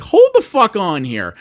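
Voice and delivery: indignant outburst. Retold or imitated. Customer cutting off support rep, loud indignant outburst. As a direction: stop the support guy dead in his tracks.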